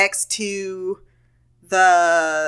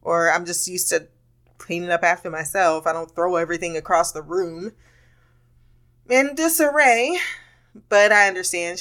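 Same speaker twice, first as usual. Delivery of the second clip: ordinary talk, nothing marked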